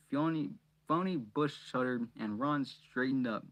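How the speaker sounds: ordinary delivery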